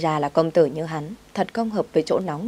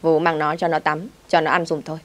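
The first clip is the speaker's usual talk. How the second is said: usual speaking voice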